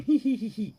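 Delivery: squeaky high-pitched hee-hee giggle; high mock giggle. Mimicking the ghost in the shower with a high giggle. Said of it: "taking a shower and going".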